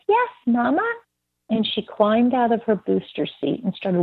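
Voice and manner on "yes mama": put-on high-pitched toddler reply; imitated little girl. Toddler answering her mother, imitated child voice. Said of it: "And she said".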